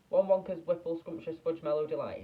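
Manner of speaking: high, loud, bursting with eagerness; eager child's order. Acted. Hungry boy ordering chocolate from the shopkeeper, eager and loud. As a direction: blurt out the name of the treat he's dreamed of.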